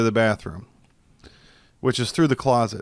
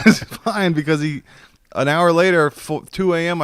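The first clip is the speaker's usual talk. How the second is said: excited; 6 semitones higher, 4 dB louder than usual. Real, not acted. Excited retelling, voice jumps up.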